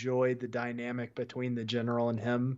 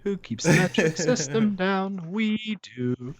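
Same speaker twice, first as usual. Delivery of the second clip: raised, ominous secret-society voice; mock sinister conspiracy voice; 5 semitones higher, 4 dB louder than usual. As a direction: intone it like a shadowy cabal leader. Mock conspiracy chant about British.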